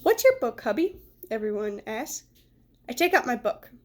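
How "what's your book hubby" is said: put-on kids' voices. Kids asking, voiced high.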